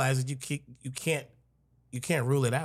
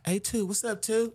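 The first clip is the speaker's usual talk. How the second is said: mock greeting; 10 semitones higher than usual. Imitates people calling him two.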